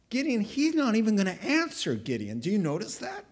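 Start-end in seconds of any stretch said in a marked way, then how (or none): none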